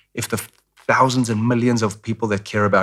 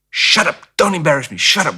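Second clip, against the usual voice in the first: sharp scolding mother, higher and louder; strict mom voice; 7 semitones higher, 4 dB louder than usual. Retold or imitated. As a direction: snap at the kid like an embarrassed mom. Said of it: "she'd go".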